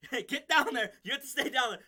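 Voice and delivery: hammed-up overbearing boss; mock bossy order. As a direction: order them down, half-laughing. Boss forces subordinate's head down.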